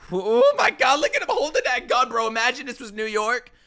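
high, loud fan outburst; excited disbelief. Real, not acted. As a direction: exclaim loudly at the screen. Excited reaction to the gun scene.

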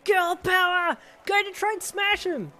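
very high, loud fan yelling; shouted cheer. A narrator's reading. Best friend in the stands urging her on, shouting. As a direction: cheer your friend on at full pitch. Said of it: "Kiro shouted".

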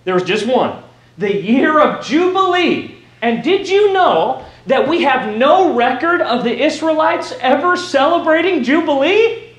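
ordinary delivery

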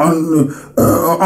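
normal talking voice

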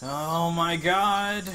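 frantic urging, rising in panic; scared horror game streamer. Real, not acted.

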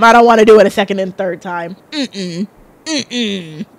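normal talking voice

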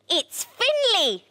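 high, stretched excited hen voice; high-pitched storybook hen; clucking hen. A narrator's reading. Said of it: "clucked Henrietta to the other hens".

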